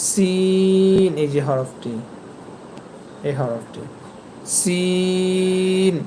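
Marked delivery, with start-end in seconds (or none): 0.1-1.1 সিন held long and slow as Arabic sin; Arabic letter demo. Shown as a demonstration. Teacher drawing out সিন for the students. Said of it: "এর পরের হরফটি হবে". 4.6-5.9 সিন drawn out very slowly, hissing s held; Arabic letter demo. Shown as a demonstration. Teacher repeating সিন slowly for learners. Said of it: "এই যে একটা শব্দ আসছে দেখছেন".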